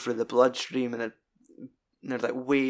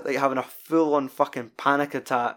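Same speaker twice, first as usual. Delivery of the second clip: usual speaking voice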